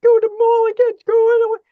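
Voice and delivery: high-pitched whiny imitated sobbing voice; student calling her teacher late at night, whining in a high tearful voice; mock crying teen. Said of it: "at night crying".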